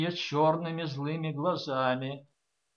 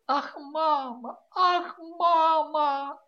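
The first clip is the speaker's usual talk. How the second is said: high, slow tearful pleading, rising to louder; a daughter keeps begging her mother through tears; 10 semitones higher, 4 dB louder, much slower than usual. A narrator's reading. Высоко и медленно, сквозь слёзы. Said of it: "твердила сквозь слёзы".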